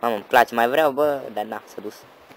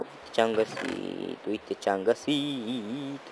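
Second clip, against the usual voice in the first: soft, very stretched-out words; slow, soft, drawn-out gloat; gloating drawl; 8 dB quieter, much slower than usual. Real, not acted. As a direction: draw out each word teasingly. Gloating over found chest.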